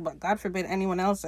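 ordinary talk

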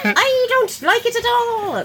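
high, slow, hammed-up Irish brogue; jokey Irish leprechaun voice, up high; mock Irish accent for a friend; Lucky Charms Irish accent. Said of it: "That's my Irish accent for him".

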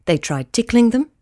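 ordinary delivery, nothing marked

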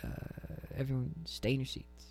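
airline captain. Pretend. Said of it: "this is your captain speaking".

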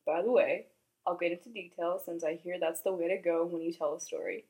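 quiet, even read-aloud storytelling; hushed bedtime-style story reading; story narration. Acted. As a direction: read the story softly to the viewers. Reads editor's story aloud.

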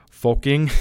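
"fucking" said as "foking", with an O sound; imitated accent, "fucking" drawn out as "foking"; Steiner's accented pronunciation. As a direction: swap the U in "fucking" for an O. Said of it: "it's F O K".

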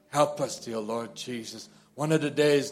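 ordinary talk

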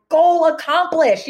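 high, loud, slow celebration; triumphant exclamation. Retold or imitated. Author reacting to her daughter's words, triumphant exclamation. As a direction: draw it out high and proud, like a victory.

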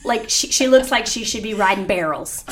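usual speaking voice

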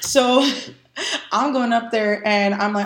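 normal talking voice

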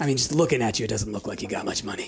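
lightly voiced sneaky lizard folk; sly thief voice.

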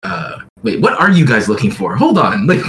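ordinary talk

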